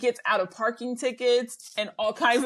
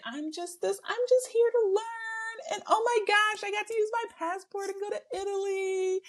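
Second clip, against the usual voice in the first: mock gushing; 10 semitones higher than usual. Podcaster mimicking someone thrilled about learning and Italy, mock gushing. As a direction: put on a high, gushing learner's voice.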